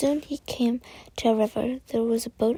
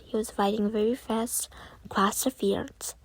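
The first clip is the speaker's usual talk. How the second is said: usual speaking voice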